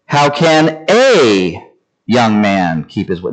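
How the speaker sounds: "a" said as a long "ay"; slow reading that singles out "a"; stress on "a". Shown as a demonstration. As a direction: stretch "a", pause, then read on. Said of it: "Then I do the next word".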